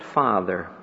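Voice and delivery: soft, hushed "Father", spoken low and tender; whisper-soft "Father" like a dying prayer. Acted. Jesus' word on the cross, hushed.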